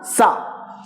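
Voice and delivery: "sa" begun with an unvoiced s; s sound demo. Shown as a demonstration. Teacher likens it to Hindi, "sa" with an s. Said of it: "that is Hindi hota na".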